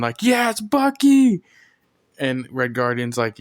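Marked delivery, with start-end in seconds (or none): 0.2-1.4 high, loud, drawn-out mock cheer. John Walker cheering Bucky on after the truck flip, cheering. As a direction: cheer it out like an excited fan. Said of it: "John Walker's cheering".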